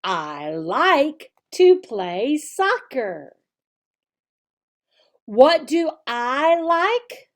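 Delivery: slow, exaggerated teacher voice, brighter on questions; slow teacher modeling. Shown as a demonstration. Teacher modeling the lesson for children, slow and clear. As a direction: model each sentence slowly and clearly for little kids. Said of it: "The, the page actually says".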